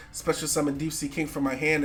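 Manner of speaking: usual speaking voice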